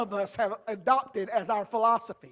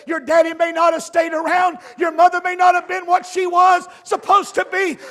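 bold declaration; 6 semitones higher, 8 dB louder than usual. Retold or imitated. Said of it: "a boldness and a confidence that says".

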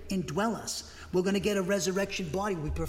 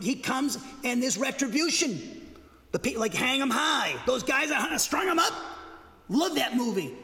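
high, loud gusto; relishing; 7 semitones higher, 4 dB louder than usual. Real, not acted. Preacher praising justice movies, high and loud. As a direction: savour the retribution.

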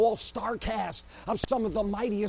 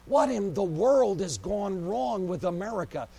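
normal talking voice

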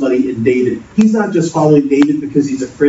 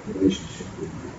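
whispered question; 13 dB quieter, faster than usual. Acted. Pastor drops to a whisper.

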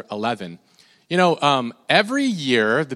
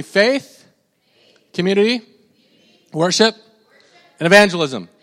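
loud, high prompts to repeat; call-and-response; 6 semitones higher, 6 dB louder than usual. Shown as a demonstration. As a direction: lead the room word by word. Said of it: "can you guys say with me".

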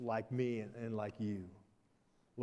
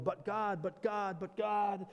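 pleading whine, pitched higher; whining Moses; 6 semitones higher, slower than usual. Acted. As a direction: beg like a stubborn Moses. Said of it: "Moses is always going".

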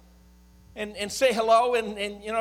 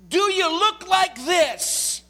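"Do you look like this?" thrown out loud and slow, leaning on "like"; loud challenge; 9 semitones higher, 5 dB louder, much slower than usual. Acted. Pastor challenging his congregation, loud and slow. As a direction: demand it of the room, slow and strong. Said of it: "I love you enough to ask you a question".

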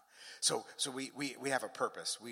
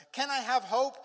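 high, louder searching question; acted pleading; 12 semitones higher, 9 dB louder than usual. Believer asking the Father for hope, high insistent pleading.

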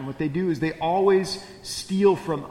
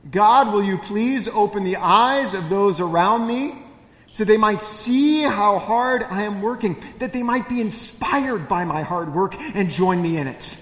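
louder, higher, exasperated mock prayer; self-pitying prayer; 4 semitones higher, 5 dB louder than usual. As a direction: plead like the overworked martyr. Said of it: "I can just hear myself".

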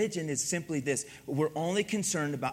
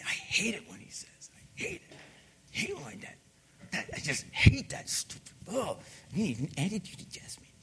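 imitated whispered grumble, much softer; muttered resentful griping; 6 dB quieter than usual. Son griping privately about his dad, whispered mock resentment. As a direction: grumble under your breath, mocking the parent's phrase. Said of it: "And I used to say".

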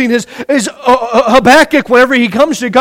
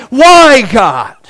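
anguished cry; 3 dB louder than usual. Pretend. Climax of voiced prayer.

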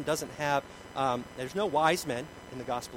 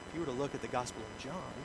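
ordinary talk, nothing marked

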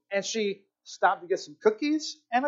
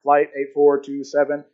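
slow, lower announcer imitation; loudspeaker announcement; 6 semitones lower, 4 dB louder than usual. Airline announcer calling the flight over loudspeaker. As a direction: mimic an airport PA call. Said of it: "over the loudspeaker".

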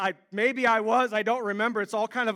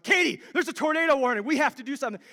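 rushed, frantic warning; freaking out at his wife; panicky; 2 semitones higher, faster than usual. Retold or imitated. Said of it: "No, I was like".

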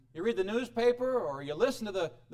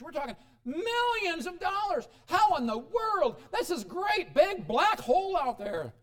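higher, louder fretting about money; anxious worry; 8 semitones higher, 4 dB louder than usual. Real, not acted. Pastor to church, fretting over land prices, anxious. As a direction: let the panic rise over the cost.

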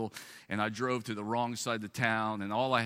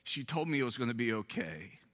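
usual speaking voice

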